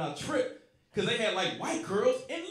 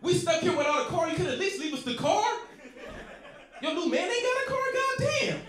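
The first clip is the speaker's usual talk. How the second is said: mock yelling at ex; 10 semitones higher than usual. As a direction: blow up at her, half laughing. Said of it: "And I'm like".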